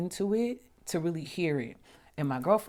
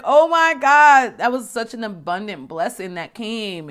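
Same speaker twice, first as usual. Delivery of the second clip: much higher, much louder, slow joyful cry; overjoyed exclaim; 7 semitones higher, 15 dB louder, slower than usual. Retold or imitated. Retelling the moment her money arrived, loud delighted exclaim. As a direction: burst out in thrilled disbelief.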